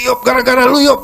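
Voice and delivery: loud, high blaming yell; furious retold shout. Angry friend yells at the speaker for his gambling losses, high loud shout. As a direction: hurl the blame at him in a rage. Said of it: "Ngamuk dia".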